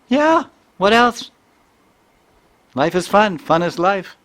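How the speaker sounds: put-on very high, loud kid stating the obvious; exuberant child imitation. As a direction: shout it out like a delighted child. Voicing child's enthusiastic answer.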